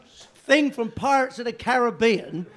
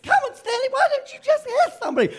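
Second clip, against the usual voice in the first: much higher, fast falsetto wife through laughter; mock wife voice; faster than usual. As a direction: squeak out the nagging wife while laughing. Imitating Stanley's wife, laughing.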